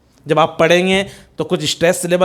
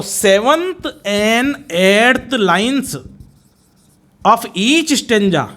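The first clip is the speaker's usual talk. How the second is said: drawn-out, word-by-word delivery; each word stretched and set apart; slow dictation; much slower than usual. Real, not acted. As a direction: dictate slowly for note-takers. Teacher dictates a key note.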